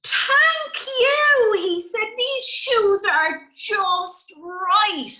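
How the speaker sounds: very high, loud and slow; delighted character. Acted. Gigi thrilled with the shoes, very high and loud. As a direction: squeal the thanks high.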